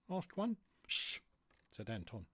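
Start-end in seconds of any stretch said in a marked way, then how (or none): 0.9-1.2 drawn unvoiced shh; hushing shh. Anton hushes his companion.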